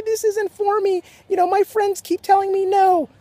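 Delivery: very high-pitched whiny quitter; mock whining. Quitter complaining about friends' rejections, mock whining. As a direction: put on a complaining, give-up whine. Mocks people who quit.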